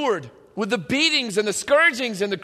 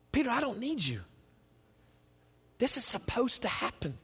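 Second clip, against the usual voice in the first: quiet voice of Jesus; 10 dB quieter than usual. Retold or imitated. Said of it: "he looks at Peter and says".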